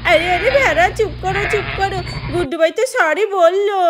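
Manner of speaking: put-on little child pleading with the angry sheep.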